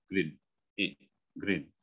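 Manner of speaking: GREEN as grin, I as a brief lax ih; short GREEN with the jaw barely moving; short vowel. Shown as a demonstration. Coach showing grin without opening the jaw, short vowel. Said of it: "nggak buka rahang sama sekali".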